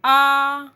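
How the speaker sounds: "आ" held with the mouth fully open and loud; wide open aa. Shown as a demonstration. Teacher showing students the open vowel, loud and wide. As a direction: belt out "आ" with the jaw dropped wide. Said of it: "विवृत।".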